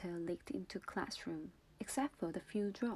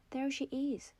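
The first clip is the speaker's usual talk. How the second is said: a raised, higher shout of discovery; 6 semitones higher than usual. A narrator's reading. Trot points out the teacher to the mob, shouting. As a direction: call her out across the room. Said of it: "Trot shouted".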